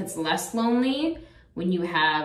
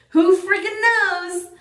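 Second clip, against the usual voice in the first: high, loud and drawn-out exasperation; exasperated; 12 semitones higher, 6 dB louder, slower than usual. Real, not acted. Vlogger to viewers, exasperated about the quarantine. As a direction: throw the question up in the air, much higher and louder.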